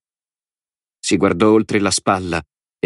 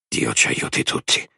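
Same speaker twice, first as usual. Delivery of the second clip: soft, low whispered prayer in the mind; breathed under his breath in dread; whispered thought; 6 semitones lower, 4 dB quieter than usual. A narrator's reading. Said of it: "pensò". (preghiera sussurrata)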